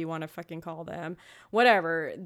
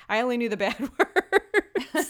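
laughing through the words; 5 semitones higher, 6 dB louder than usual. Real, not acted. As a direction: giggle it out, embarrassed. Laughing confession.

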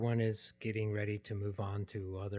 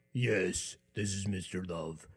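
put-on deeper, manly phone voice; deepened voice; 4 semitones lower, slower than usual. Answering a caller who said ma'am, deliberately deepened voice. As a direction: deepen the timbre to prove you're the man. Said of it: "And I deepen the timbre of my voice".

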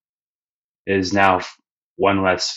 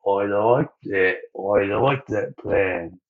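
pleased slow drawl; 5 dB quieter, slower than usual. Retold or imitated. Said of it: "And Luffy's like".

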